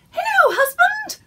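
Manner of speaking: very high, loud squeaking tiny woman; tiny wife squeaks a threat; squeaky voice. Acted. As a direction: squeak like a pepper-pot-sized wife. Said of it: "squeaked Missus Pepperpot".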